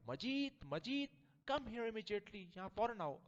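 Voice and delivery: high, loud call for the servant. Acted. Mistress calling loudly for her servant. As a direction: shout the servant's name across the house. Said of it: "अब वो चिल्ला रही हैं".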